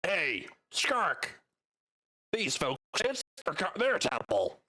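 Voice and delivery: goblin shouting. Acted. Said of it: "shouts at this goblin over here".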